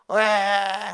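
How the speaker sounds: mock whiny child's wail; a short whining "eung" from a scolded child; child's whimper. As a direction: imitate a small kid starting to cry. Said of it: "하고 우는 거예요".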